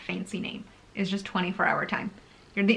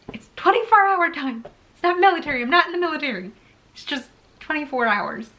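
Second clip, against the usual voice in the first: higher and louder, insisting it's not military; exasperated insistence; 6 semitones higher, 3 dB louder than usual. Real, not acted. Vlogger correcting viewers who say military time, exasperated. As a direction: set the record straight, exasperated.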